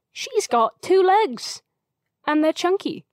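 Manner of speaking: slow, high-pitched mock headline proclamation; tabloid headline voice. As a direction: blare it like a trashy tabloid. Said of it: "one of those newspapers that's like".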